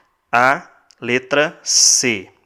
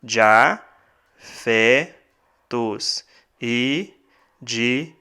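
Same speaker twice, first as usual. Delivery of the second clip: split into syllables; much slower than usual. Shown as a demonstration. Teacher scanning the final verse for students, slow syllable by syllable. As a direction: scan the verse with long gaps between syllables. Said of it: "Veja aqui nesse último".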